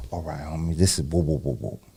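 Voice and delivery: low, hushed send-off; murmured low farewell; talking real low. Retold or imitated. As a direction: keep it quiet and low as they back off. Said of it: "They talk to me real low".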